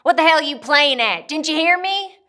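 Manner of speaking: loud, high, furious demand; angry drunk father. Acted. Father bursts in on his girls, angry shouting. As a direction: yell it as a threat.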